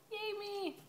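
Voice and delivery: high, soft mock "yay"; deadpan fake celebration; sarcastic cheer. Real, not acted. Sarcastic reaction to stronger accent.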